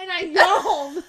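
slow laughing confession. Real, not acted. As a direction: laugh it out slowly. Laughing about yawning.

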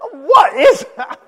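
loud, high, drawn-out disbelief; hammed-up baffled cry; retold bewilderment. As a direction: exclaim in exaggerated shock, slowly. Reacts to his own retold crying.

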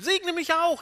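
acted high-pitched wail; weeping cry. Esau begging his father to bless him too, wailing. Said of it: "Er weint, er schreit".

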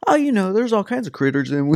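high-pitched mimicry of a friend; mock casual chatter, pitched high; mocking imitation. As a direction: tease in a put-on high voice. Mimics friend slipping in the word critters.

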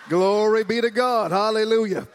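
loud, high church exclamation; exultant praise. Real, not acted. Preacher praising God before reading Luke, exultant praise. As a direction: proclaim praise to the whole congregation.

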